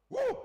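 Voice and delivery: short, loud, high "wouh!"; amazed whoop. Real, not acted. Preacher exclaims at huge sum.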